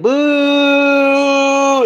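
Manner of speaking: loud high booing yell; a big drawn-out Apollo boo; crowd booing. Retold or imitated. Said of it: "and everybody said".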